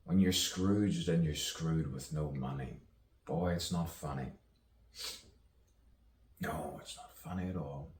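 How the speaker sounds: measured poetic recital with long pauses; spoken-word poetry. Acted. Poet musing aloud on misunderstanding and rebirth, spoken-word delivery. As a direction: recite it as free verse, letting each phrase hang.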